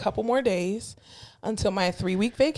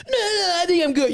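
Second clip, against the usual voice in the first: put-on meek old patient; 7 semitones higher than usual. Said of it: "patient answering meekly".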